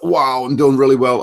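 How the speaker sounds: thrilled about how it's going; excited. Retold or imitated. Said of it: "I felt like".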